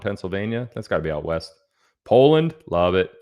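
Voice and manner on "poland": excited shout-out. Real, not acted.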